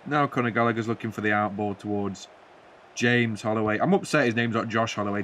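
normal talking voice

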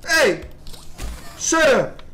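loud, high yell telling them to shut up; irritated shout. Real, not acted. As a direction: bark it at the noisy enemies. Yelling at game enemies.

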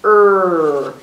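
"er" as one long, loud growled R; drawn-out, forceful "er"; R sound. Shown as a demonstration. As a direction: hold the R sound, "er". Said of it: "And our sound is".

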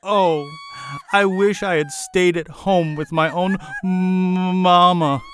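tearful pleading. A narrator's reading. Queen wails she wants to go home, tearful. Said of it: "her voice choked with sobs".

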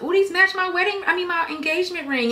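high, squealy delight over the ring; gushing. Retold or imitated. Showing off her ring to viewers, high gushing. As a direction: coo over the ring like a thrilled girl.

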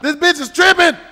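loud, high exasperated outburst; worked-up yelling complaint; indignant shouting. Pretend. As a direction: holler in outrage at the game. Outburst after losing in game.

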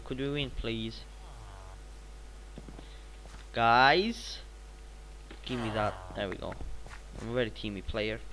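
normal talking voice

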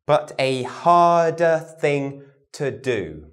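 slow, deliberate tongue twister; slow group repeat. Shown as a demonstration. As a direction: lead the group slowly word by word. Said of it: "repeat with me".

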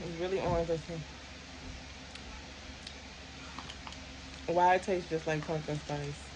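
eating a cookie while speaking; talking while chewing. Real, not acted. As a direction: mouth partly full of cookie. Seen 0: Bites sandwich cookie at 0.2–0.9, then talks while chewing.

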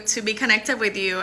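ordinary talk

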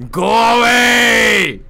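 stretched, very loud yell; long shout. Acted. Player yelling at the monster through the mic, long shout. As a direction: bellow it slowly to scare the thing off. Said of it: "Go awayだけでいいんじゃね".

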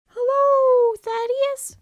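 very high, far-off squeaky goat voice; a tiny high voice calling from a fall; falling goat's cry. Acted. The uncle tumbling into a canyon, calling up in a high squeaky voice.

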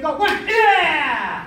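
loud, punchy count with a yell; drill command. Real, not acted. Coach starts the students' strikes, loud drill shout. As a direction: call the strike out hard.

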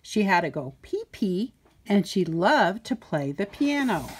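plain reading voice

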